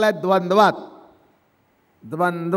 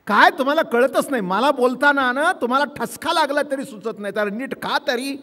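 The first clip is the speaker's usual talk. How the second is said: scolding; 7 semitones higher than usual. Acted. Spouse scolding at dinner.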